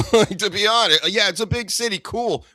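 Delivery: sarcastic dismissal. Real, not acted. Mocking New York pride.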